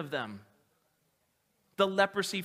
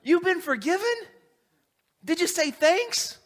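scolding mom; 12 semitones higher, 8 dB louder than usual. Retold or imitated. A mom scolds her healed son for not thanking Jesus, high and shrill-loud. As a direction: play a shocked scolding mother. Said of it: "their moms were like".

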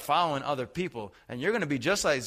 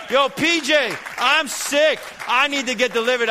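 loud, high cry for help; desperate call; 9 semitones higher, 7 dB louder than usual. Acted. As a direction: call out urgently, voice raised high.

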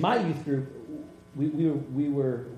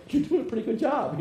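joking; 10 semitones higher, 5 dB louder than usual. Retold or imitated. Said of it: "I remember jokingly".

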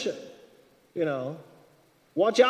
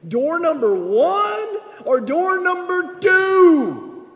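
much higher, much louder, drawn out like a game show host; exaggerated loud slow; 10 semitones higher, 8 dB louder, much slower than usual. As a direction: ham up the choice like a quiz show. Pushing God for a choice.

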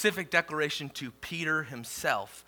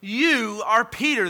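louder and higher scripture proclamation; preaching at full force; 7 semitones higher, 6 dB louder than usual. Acted. Pastor reading Jesus's words to Peter, preaching at full force. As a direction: declare the verse boldly.

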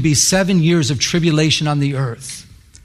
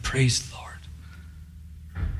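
slow, whispered thanks; hushed praise; 4 semitones lower, 13 dB quieter, much slower than usual. Retold or imitated. Preacher moved by a young man's tears, whispering. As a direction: whisper it slowly in quiet awe.

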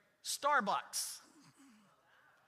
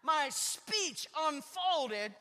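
much louder, higher, slowed confession; emphatic preaching; 7 semitones higher, 10 dB louder, slower than usual. Real, not acted. Pastor admitting to the congregation he was cranky, loud and emphatic. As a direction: belt it out to the back row.

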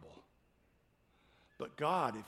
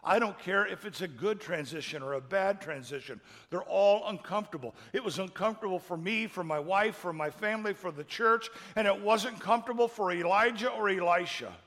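louder, building emphasis on uncomfortable; rising preaching; 5 semitones higher, 7 dB louder than usual. Acted. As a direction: drive the point home to the church. Preaching at full force.

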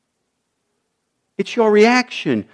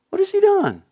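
pleading question; 13 semitones higher than usual. Acted. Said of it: "Jonathan asked his father".

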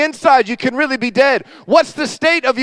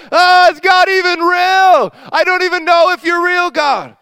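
mock despair; 6 semitones higher, 4 dB louder than usual. Believer doubting God in crisis.

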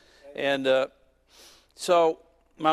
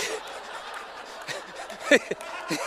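breathless laugh; 6 semitones higher, 3 dB quieter than usual. Real, not acted. Laughing at his own story.

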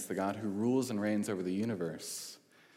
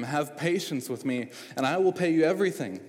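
desperate plea rising higher and louder toward the end; imploring; 6 semitones higher, 5 dB louder than usual. A narrator's reading. Servant begging his master for patience, pleading. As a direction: beg the master for more time. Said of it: "imploring him".

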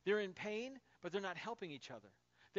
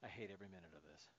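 much lower, softer, fast mutter; grumbling under breath; 10 semitones lower, 11 dB quieter, faster than usual. Retold or imitated. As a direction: mutter it sulkily from the corner. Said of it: "I sit in the corner going".

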